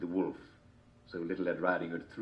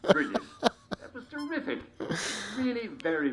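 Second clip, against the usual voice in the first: host praise. Acted.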